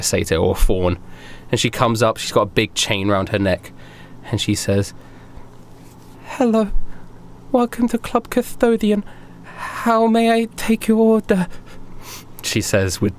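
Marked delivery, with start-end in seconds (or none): 6.2-11.5 high, halting, timid greeting; small high voice, anxious to please; nervous waitress. Acted. Waitress greets customers at the club, nervously. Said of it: "clear nervousness in her voice".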